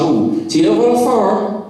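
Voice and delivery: teasing mock West Country accent; mock Somerset accent. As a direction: imitate a nosy stranger in a Somerset accent. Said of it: "always in that accent".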